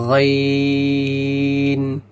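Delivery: গইন stretched over four alifs; long drawn sound. Shown as a demonstration. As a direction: hold গইন long, with a throaty গ. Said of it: "এবং চার আলিফ টান হবে". (গইন চার আলিফ লম্বা টেনে)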